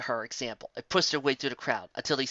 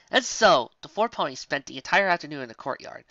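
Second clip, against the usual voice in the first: ordinary delivery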